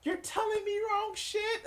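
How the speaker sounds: high, shrill mocking falsetto of clutching pearls; mock the prissy hand-wringer way up high; sneering at fake moral panic; mocking fake outrage. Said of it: "fake moral".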